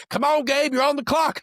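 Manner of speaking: high-pitched retold urging, hurrying a student; urgent instructor. Instructor to student sweating through a timed mortar test, urgent and high. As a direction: imitate an instructor pushing a trainee along.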